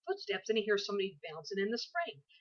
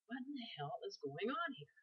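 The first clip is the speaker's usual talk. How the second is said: soft, higher-pitched retold bewilderment; hushed disbelief; 4 semitones higher, 9 dB quieter than usual. Mother recalling her reaction to her scared son, hushed disbelief. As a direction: say it under your breath, pitch lifted.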